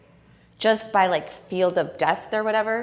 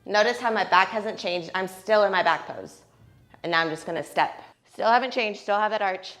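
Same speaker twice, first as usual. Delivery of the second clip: ordinary delivery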